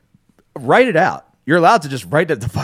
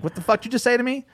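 fast, much higher mock outburst of rage; Jersey rage; 11 semitones higher, faster than usual. Host mimics his own angry reaction to a rude listener, fast and pitched way up. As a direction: snap back like an offended guy from Jersey. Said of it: "my Jersey rage comes out".

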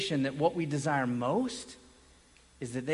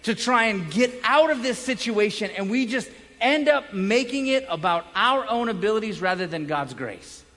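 raised, fast, impassioned sermon; preaching; 7 semitones higher, 6 dB louder than usual. Acted. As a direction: preach it out to the room with full force. Preaching at full force.

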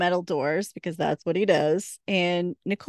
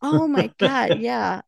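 mock excited Bobo; 5 semitones higher, 5 dB louder, slower than usual. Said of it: "He's like".

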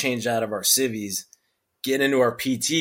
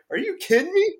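high pitched disbelief; incredulous high question. Retold or imitated. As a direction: squeak it in disbelief. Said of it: "You're like".

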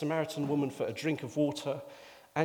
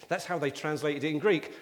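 ordinary delivery

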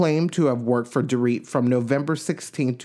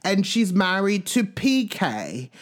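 higher, put-on posh British accent; a hammed-up fake British accent, pitched up; Dorit's British accent; 6 semitones higher than usual. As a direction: mimic a Connecticut woman faking a British accent. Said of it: "I did that accent because Dorit is from Connecticut, but she talks with a British accent".

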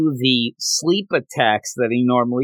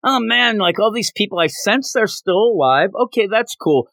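higher and gleeful, a hammed-up thrill; mock excitement; 8 semitones higher than usual. Host retelling the anime scene to his co-host, in mock excitement. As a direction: play a cocky boy sensing the trapped people. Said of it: "it's so funny 'cause he's like".

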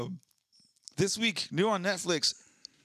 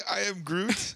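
mock Groot; 7 semitones higher than usual. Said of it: "Did you just say".

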